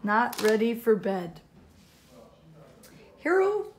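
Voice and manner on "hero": calling out. A narrator's reading. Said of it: "Father called from the living room".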